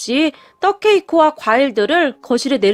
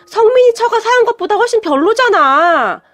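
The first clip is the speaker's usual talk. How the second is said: high, whining complaint with a pout; whiny pout; 5 semitones higher, 3 dB louder than usual. A narrator's reading. Mother-in-law whining at the cake, high and sulky. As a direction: sulk like a spoiled child. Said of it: "라며 울상을 지었거든요".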